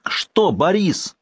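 high, slow, drawn-out yell; outraged, drawn-out shout; furious yell. Acted. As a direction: bellow it at the old man. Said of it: "заорал он так".

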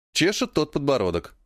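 usual speaking voice

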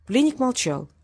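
usual speaking voice

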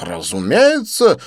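slow, high and loud boast; proud. Acted. Didier boasting proudly to Lin, slow high proud. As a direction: puff up your chest and drawl it out. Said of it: "он гордо выпятил грудь".

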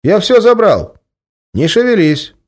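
put-on high, loud young voice calling from behind; a young guy catching a thief; young man's voice. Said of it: "раздался сзади молодой голос".

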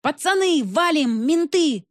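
high panicked shriek. A narrator's reading. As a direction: squeal a warning to run. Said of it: "завизжал кто-то".

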